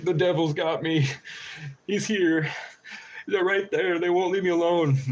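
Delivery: high, frantic mock terror; hammed-up fear for a video; mock panic. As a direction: pretend haunted man filming himself. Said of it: "I record a video like".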